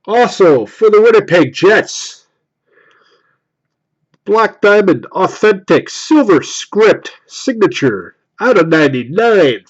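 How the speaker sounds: loud, drawn-out hype announcement; card reveal announcer. Acted. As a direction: present each card like a prize. Announces pulled card like host.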